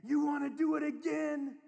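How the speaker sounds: high, loud cheer; acted over-the-top parental enthusiasm; excited dad. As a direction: whoop with pride to urge the child on.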